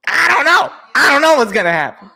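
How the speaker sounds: high-pitched exclaiming; keyed-up, high-voiced cluelessness; worked-up uncertainty. Real, not acted. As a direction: throw it out excitedly, pitch way up. Worked up as next pick arrives.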